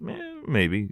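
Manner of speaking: low, soft imitated shrug of an answer; imitating a teasing dodge; teasing noncommittal. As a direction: play it coy and offhand, quietly. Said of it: "some kind of teasing response".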